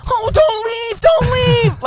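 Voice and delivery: very high loud call, drawn out; shrill neighbor voice. Retold or imitated. The neighbor calls out to her at the car window in a very high loud voice. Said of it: "Like that".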